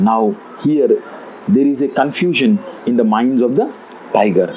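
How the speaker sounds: ordinary talk, nothing marked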